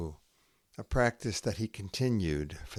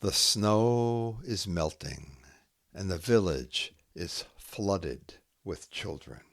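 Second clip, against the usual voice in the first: slow haiku reading with long pauses; poem reading; 7 semitones higher, slower than usual. Acted. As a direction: let each image hang in the silence.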